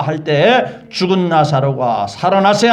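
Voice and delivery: ordinary talk, nothing marked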